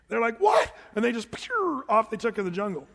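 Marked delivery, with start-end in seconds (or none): 0.4-0.7 high, loud mock alarm; freaked-out cry. Preacher imitating jungle guides seeing the boa, freaked-out. 1.3-1.8 high zipping sound effect; whooshing getaway noise. Retold or imitated. Preacher showing guides taking off in the jungle, zooming noise. Imitating guides bolting away.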